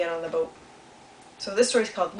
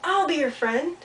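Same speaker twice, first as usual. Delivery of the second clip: echo; 6 semitones higher than usual. Acted. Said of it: "and then he heard".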